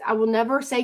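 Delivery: ordinary talk, nothing marked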